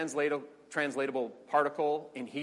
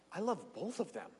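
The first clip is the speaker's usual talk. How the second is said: softer, aching answer; Abraham pleading with God softly; quiet pained admission; 3 semitones higher, 9 dB quieter than usual. Acted. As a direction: barely say it. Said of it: "Abraham says back to God".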